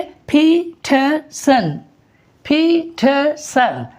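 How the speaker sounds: slow पीटरसन as phee, thuh, sun; syllable by syllable. Shown as a demonstration. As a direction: separate पीटरसन into three beats with airy P and T. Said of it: "क्या बोलेंगे?".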